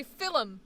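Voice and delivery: "film" in two syllables, FIL-um; Irish pronunciation. Acted. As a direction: slip an extra vowel into "film", Irish style. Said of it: "he would say "film".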